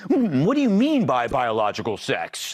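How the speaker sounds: mock feigned ignorance. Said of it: "deliberate failure to grasp the obvious".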